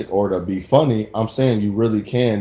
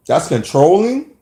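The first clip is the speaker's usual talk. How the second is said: higher pitch climbing in disbelief; incredulous question; 4 semitones higher than usual. Acted. Man questioning a woman's charge over chores, incredulous. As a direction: challenge it with a sharply rising end. Questioning accusation of being controlling.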